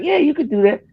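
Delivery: high-pitched imitation of an opponent; high, keen retold reply; eager agreement. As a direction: pitch it way up, mock-encouraging. Same opponent agreeing once more.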